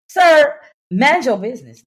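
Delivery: high, loud "Sir"; loud scolding. Real, not acted. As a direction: call him out sharply. Scolding the nosy friend.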